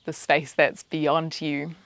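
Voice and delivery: soft, even meditation voice, spaced out with pauses; quiet, unhurried mindfulness cueing; guided meditation. Acted. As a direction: guide their attention gently through the senses. Leads a meditation.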